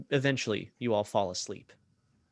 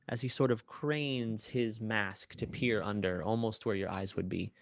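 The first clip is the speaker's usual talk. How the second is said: usual speaking voice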